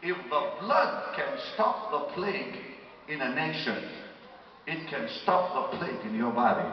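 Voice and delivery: loud, slow, emphatic sermon delivery; forceful preaching. Real, not acted. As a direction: drive each phrase home to the congregation.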